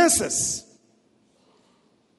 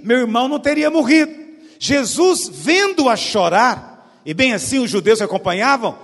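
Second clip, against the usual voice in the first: louder, solemn Bible reading; scripture reading; 4 semitones higher, 7 dB louder than usual. Acted. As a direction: read the passage like proclaiming it. Reads scripture aloud to congregation.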